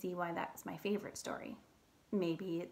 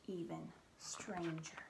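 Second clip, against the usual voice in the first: usual speaking voice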